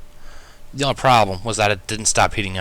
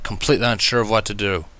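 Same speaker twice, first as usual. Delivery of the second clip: ordinary delivery, nothing marked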